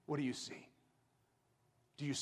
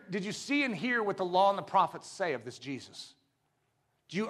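ordinary delivery

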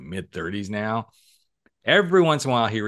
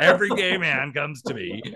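higher mock boast; teasing mimicry; 6 semitones higher than usual. Host parroting guest's joke back at him, teasing mimicry. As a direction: echo his boast back, pitched up.

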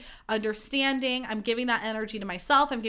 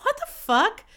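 retold shock; 10 semitones higher, 4 dB louder, slower than usual. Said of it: "And I was like".

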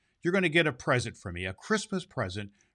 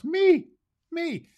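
squeaky high, much higher me, me; mock squeal; 7 dB louder than usual. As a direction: squeal it like an excited kid.

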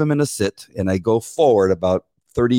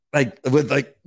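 usual speaking voice

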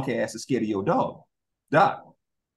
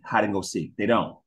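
ordinary talk, nothing marked

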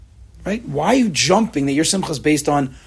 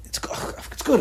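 whispered reassurance; 10 semitones higher, 5 dB quieter than usual. Retold or imitated. Man admitting it's good.

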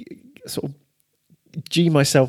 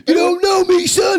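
high, loud shouted hype; shouting hype; 5 dB louder than usual. Pretend. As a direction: bellow it like a gym bro. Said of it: "riffing on the pump-up line".

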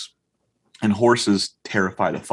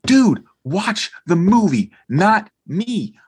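high, loud, slow telling-off; exasperated scolding; 11 semitones higher, 6 dB louder, much slower than usual. Retold or imitated. Dad to his staring son in the theater, exasperated. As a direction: hammer it out word by word, mock exasperated.

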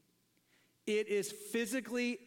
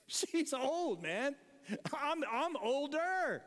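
put-on exasperated old husband, pitched up; comic higher-pitched protest to the Lord; Abraham; 6 semitones higher than usual. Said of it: "And if I'm Abraham, I'm saying".

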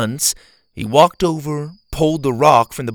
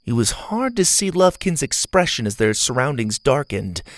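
plain reading voice

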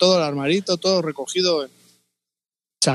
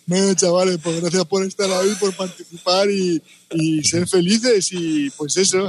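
despedida desganada; 3 semitones higher, slower than usual. Retold or imitated. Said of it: "La mierda de despedida".

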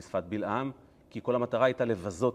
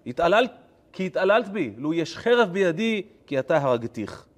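loud, raised-pitch declaimed anger; angry retort; 7 semitones higher, 9 dB louder than usual. Acted. Balaam answering his donkey in verse, angry retort. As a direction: hurl it back at full voice.

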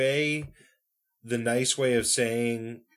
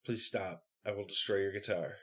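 quiet mock pleading, much softer; mock dread; 4 semitones lower, 8 dB quieter than usual. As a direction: groan it out softly in mock horror. Mock pleading against [name].

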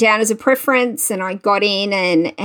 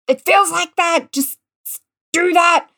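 higher, pushing urging to get it right; exasperated coaching; 8 semitones higher than usual. Retold or imitated. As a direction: coax a kid to copy the move, voice rising. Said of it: "put his body in the right position and said".

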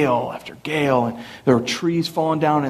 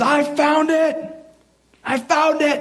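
excited calling out; 13 semitones higher, slower than usual. Retold or imitated. Mom finds candles, calling out.

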